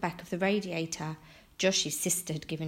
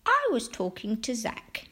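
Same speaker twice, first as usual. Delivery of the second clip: put-on pitched-up old-lady teasing; joking; 4 semitones higher than usual. A narrator's reading. Missus Cox correcting Franklin, joking. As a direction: tease him lightly in character. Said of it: "Missus Cox joked".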